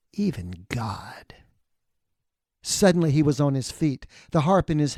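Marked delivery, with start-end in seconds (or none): 0.1-1.3 low, slow whisper; dark whisper. Acted. Stranger whispers his blasphemy to Martha, low and slow. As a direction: let it fall, hushed and menacing. Stranger's eyes grow wild.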